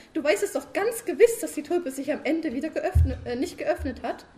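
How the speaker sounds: high, loud wife pestering her sleepy husband; nagging wife. Acted. Frau Sumsum als Figur gesprochen. (hohe, laute nörgelnde Ehefrau)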